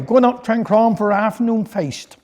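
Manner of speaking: ordinary delivery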